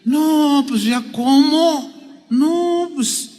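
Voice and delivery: high, soft, drawn-out "no way"; dismissive disbelief. Acted. Old Sara doubts she can have a child, acted dismissive disbelief. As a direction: wave it off in a small, doubtful voice. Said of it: "ya así como que".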